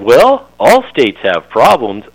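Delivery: exaggerated slow-witted neighbor; dopey voice. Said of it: "in his dopey voice that I'm gonna exaggerate for effect".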